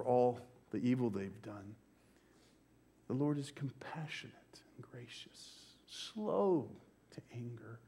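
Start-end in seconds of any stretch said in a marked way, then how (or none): 3.1-7.8 hushed, reverent whisper; a reverent whisper of the psalm to the church; whispered scripture. Real, not acted. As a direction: breathe the words in awe. Repeating the psalm line hushed.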